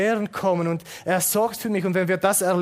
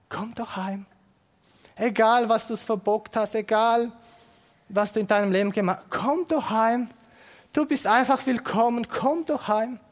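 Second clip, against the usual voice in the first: higher, warm urging call; pleading invitation; 5 semitones higher than usual. Pretend. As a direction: beg them lovingly to come home. Gott ruft das Kind heim. (inständig, liebevolle Einladung)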